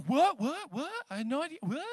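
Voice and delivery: quick repeated questioning yelps, imitating kids; kids feigning deafness. Swimming kids popping up at dad, feigned deafness. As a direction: play innocent, as if you couldn't hear. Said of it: "And they're like".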